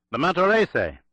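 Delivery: high, loud desperate scream. A narrator's reading. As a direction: scream the name out in terror. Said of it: "The name was screamed by Telenyakoff".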